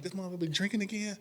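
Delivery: high, fast, teasing disbelief; mock suspicion. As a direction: rib him for sounding drunk.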